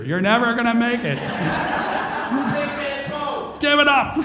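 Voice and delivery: high, laughing, mock demotivational call; cracking up while playing a demotivational speaker; laughing mock doom. Real, not acted. To the audience, laughing through a mock demotivational verdict. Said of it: "demotivational speaker".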